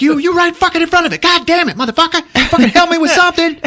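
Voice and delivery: high, loud, fast wife's rant; mock shrieking fury; acted exasperated yelling. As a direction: scream at a useless husband, hammed up. Exasperated wife yells at husband.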